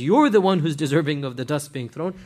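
normal talking voice

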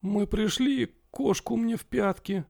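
high, hushed graveyard voice; a ghostly whisper-like pirate curse; creepy butler. Acted. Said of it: "замогильным голосом произнесла пиратка".

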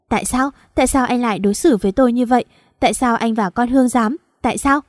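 usual speaking voice

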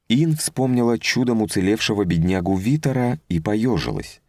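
plain reading voice

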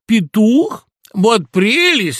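high, loud exclamation of delight; delighted cry. A narrator's reading. Fox hearing who the guest is, delighted cry. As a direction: cry out, drawing the words out. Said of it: "скричал лиса".

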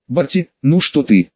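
ordinary delivery, nothing marked

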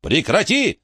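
high yell at ordinary volume; yelled order. A narrator's reading. Narrator yelling at Frankie in rage, contained high yell. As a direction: cry out a sharp, high command. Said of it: "заорал я на Фрэнки".

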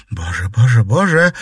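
loud rising wail; wailing lament. Acted. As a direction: wail over the dying boy. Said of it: "причитала Катерина".

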